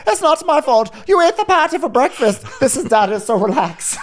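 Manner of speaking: high, put-on Jamaican voice through laughter; mock Jamaican mom. Mom blaming Sonya for eating the patty, in a high, hammed-up Jamaican voice.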